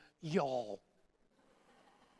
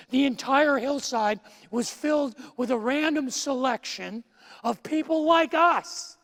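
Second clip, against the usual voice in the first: full-force preaching; 4 semitones higher, 6 dB louder than usual. Acted. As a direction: proclaim it to the congregation. Preaching builds to climax.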